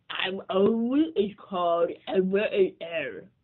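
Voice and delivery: slow, loud, puffed-up announcement; slow booming announcer voice; Tacky's big announcement. Acted. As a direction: boom it out like a proud showman. Tacky announces his story.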